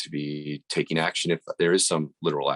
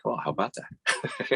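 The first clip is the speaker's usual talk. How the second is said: amused aside; 3 dB quieter than usual. Retold or imitated. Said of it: "I'm like".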